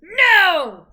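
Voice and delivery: loud high "No!"; shouted no. Shown as a demonstration. Mother shouting "No!" so her son copies it, playful. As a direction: belt it out, playful.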